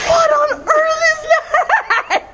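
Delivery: high, slow, laughing shriek of surprise; what on earth, drawn out and shrieked; shocked outburst. Real, not acted. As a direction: blurt it out in disbelief, half laughing.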